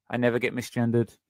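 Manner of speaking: ordinary talk, nothing marked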